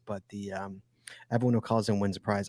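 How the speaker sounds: ordinary talk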